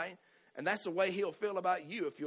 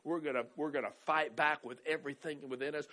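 ordinary delivery

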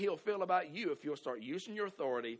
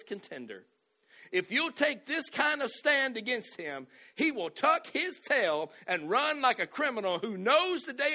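slow, rising, louder preaching read; preaching scripture; 5 semitones higher, 5 dB louder, slower than usual. Acted. Preacher pressing the verse on his church, slow and rising. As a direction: drive the verse home like a preacher.

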